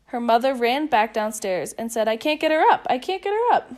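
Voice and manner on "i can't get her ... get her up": higher and louder, rising in distress; exasperated. Acted. Mother reporting failure downstairs, exasperated. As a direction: throw up your hands, she won't wake.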